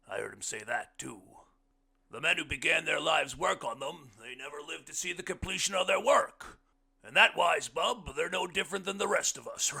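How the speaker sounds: loud, high voice building in force; big-voiced guest holding forth; hearty blind man. A narrator's reading. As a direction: boom it out, rising toward the punch line. Blind man's speech in story.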